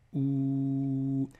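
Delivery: "ou" held long as one steady sound; long vowel. Shown as a demonstration. Teacher showing the long vowel to students, long "ou". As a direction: stretch out "ou" evenly. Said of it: "en tant que voyelle longue".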